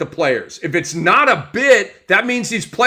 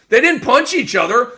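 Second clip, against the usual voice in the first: normal talking voice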